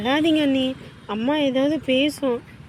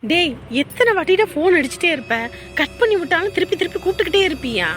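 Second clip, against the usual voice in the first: exasperated scolding; 3 semitones higher, 3 dB louder, faster than usual. Acted. Woman snapping at a friend who keeps phoning, exasperated. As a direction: tell off a pest who keeps calling. Annoyed at repeated calls.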